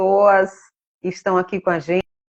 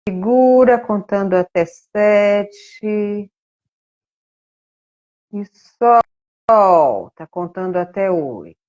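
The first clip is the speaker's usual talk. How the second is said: guided breathing; slower than usual. Shown as a demonstration. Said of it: "vamos fazer mais esse movimento".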